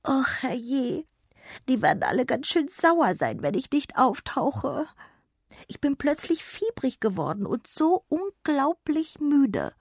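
high, frail fairy voice fretting; a feverish little fairy worrying; sick star fairy. Acted. Fairy worries to Jonna about missing her rounds, high and fretful.

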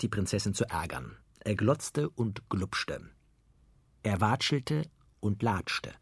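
ordinary delivery

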